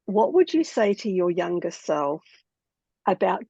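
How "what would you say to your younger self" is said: normal talking voice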